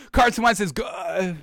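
slow, forced, the words catching in the throat; slow strained attempt at praise; struggling to say it. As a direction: fight to get the sentence out, straining. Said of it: "can't even get that word out".

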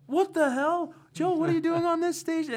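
high, loud caught-out shriek; mock shocked girlfriend yell; shocked outburst. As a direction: react like you've just been exposed.

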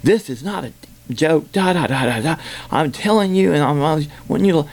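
high-pitched, exasperated retelling; worked-up mock protest; aggravated. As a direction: imitate someone fed up at not being believed. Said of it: "she got so aggravated".

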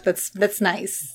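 slow, soft fake praise; patronizing. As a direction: pat them on the head verbally. Mock patronizing.

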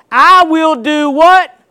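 preacher calling on the congregation to read along, loudly; call and response. Acted. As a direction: call the words out for the crowd to finish. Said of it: "read this with me".

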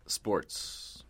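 hushed, voiceless whisper. Pretend. Host introducing the next podcast segment, mock-hushed whisper. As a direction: whisper it like a secret segment title.

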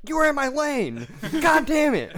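very high, loud exasperated scream; over-the-top road-rage shriek; retold yelling. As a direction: re-enact screaming at a driver, breaking into laughter. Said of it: "I was yelling at him".

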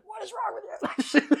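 high, slow mock upset mom voice. Son tells mom he enlisted, mom's mock upset reply. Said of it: "she was so upset".

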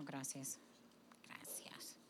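soft whispered thank-you. Real, not acted. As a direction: breathe the thanks.

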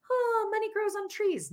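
very high airy mock-naive voice; mock dreamy wishful thinking; naive magical thinking. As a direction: send up your own wishful self, way up high. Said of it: "This was magical thinking".

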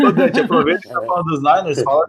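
laughing out the words, high and loud. Real, not acted. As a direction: burst out laughing while calling. Laughing at friend's joke.

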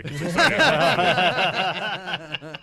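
giggly self-mockery; laughing while talking. Real, not acted. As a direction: laugh at your own silliness as you say it. Laughing at himself over soup.

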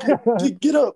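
very high, loud pleading shout; strained yell. Retold or imitated. Exhausted freshman yelling at teammates to get up, very high strained shout. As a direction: push it out while dying inside. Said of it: "it's like".

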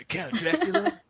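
very high, laughing mock-astonished actor impression; Keanu Reeves. Co-host mocks actor's bad accent in vampire film, high bewildered voice. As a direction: squeak it out, bewildered, half laughing. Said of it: "Keanu Reeves in Dracula".

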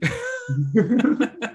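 high giggling laugh. Real, not acted. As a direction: laugh between questions. Co-host laughing.

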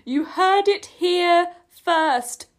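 high, loud, very slow proclamation; emphatic announcement. Real, not acted. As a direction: spell it out grandly, word by word. Finally gets phrase out, emphatically.

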